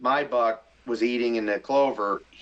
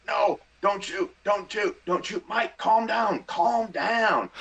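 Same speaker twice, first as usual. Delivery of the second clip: higher, pleading, half-mocking his own panic; panicky self-talk; 9 semitones higher, slower than usual. Hunter at full draw telling himself to calm down, panicky and high. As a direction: beg yourself not to shoot, voice pitched up. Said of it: "and I'm like".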